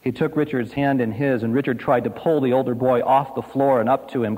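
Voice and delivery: ordinary talk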